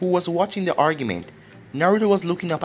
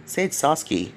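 ordinary delivery, nothing marked

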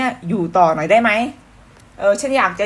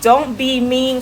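slow lyric read; 5 semitones higher, 3 dB louder, much slower than usual. Shown as a demonstration. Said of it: "เนื้อเพลง".

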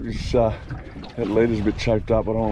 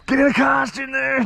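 excited shout; 12 semitones higher than usual. Real, not acted. Urging mate to cast at fish.

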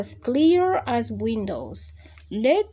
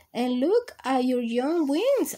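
ordinary delivery, nothing marked

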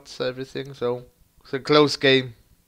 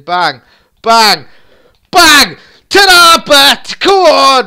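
each bang higher and louder to a shout; building goal roar; 12 semitones higher, 17 dB louder, slower than usual. Real, not acted. Gamer roaring through a goal.

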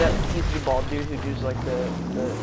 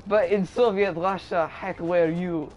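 put-on louder, slow Russian accent punchline; retry the Soviet joke louder with more punch; mock Russian accent, louder; 4 semitones higher, 5 dB louder, slower than usual. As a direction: bigger hammed-up Russian accent. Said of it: "my Russian guy".

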